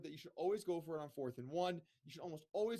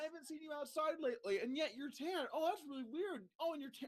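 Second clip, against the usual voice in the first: mock suspicious friend; 9 semitones higher than usual. Imitating friend's suspicious questioning.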